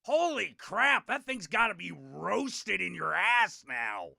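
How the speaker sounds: loud, slow, high mocking jeer. Friend insulting the girl at the party, loud mocking jeer. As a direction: bellow the crude insult at her. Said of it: "and he goes".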